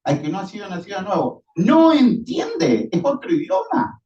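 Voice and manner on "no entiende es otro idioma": emphatic preaching. Real, not acted. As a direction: hammer home that they cannot understand. Preacher stresses spiritual incomprehension. (énfasis lento y fuerte en "no entiende")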